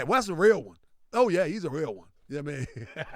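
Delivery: normal talking voice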